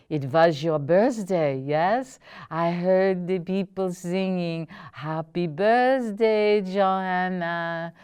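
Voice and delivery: put-on a touch of German accent, half-singing the birthday line; light German accent. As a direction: gently recall the birthday song, lightly German. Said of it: "Martha imitated".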